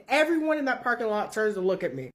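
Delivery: ordinary delivery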